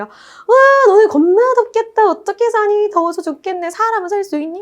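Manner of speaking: high-pitched gloating mock pity; mock teasing. Temperate climate making fun of tropical climate, mock teasing. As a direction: needle them in an exaggerated high voice. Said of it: "막 열대 기후를 놀려".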